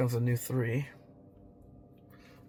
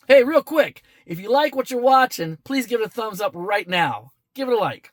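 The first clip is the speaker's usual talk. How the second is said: much higher and louder, like a YouTube ad read; upbeat promo pitch; 10 semitones higher, 7 dB louder than usual. Acted. Vlogger to viewers, asking for a thumbs up, in a bright promo voice. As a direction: switch into a cheery channel plug. Sudden upbeat plea for likes.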